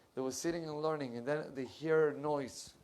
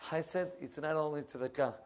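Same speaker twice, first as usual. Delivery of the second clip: usual speaking voice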